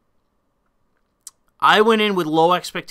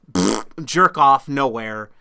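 ordinary delivery

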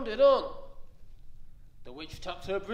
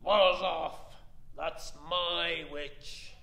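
a dripping swamp monster chasing off the dragon; growling beast voice; much slower than usual. Acted. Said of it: "was a yowl and a growl, and a croak and a shriek".

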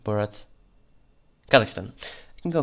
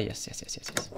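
mumbled yeses; 11 dB quieter than usual. Real, not acted. Muttering while checking papers.

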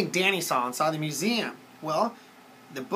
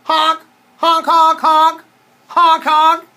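high, loud honking; car horn imitation; 10 semitones higher, 12 dB louder, much slower than usual. A narrator's reading. Dinosaur makes a noise like a car, loud honks. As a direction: honk in short bursts like a car. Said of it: "make a noise like a car".

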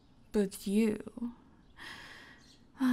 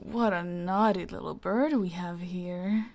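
ordinary talk, nothing marked

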